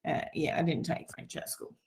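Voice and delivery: whispered, sheepish admission; sheepish aside. Real, not acted. Coach confessing she never learned French, whispered. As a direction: breathe it out, a bit embarrassed. Embarrassed aside after failed accent.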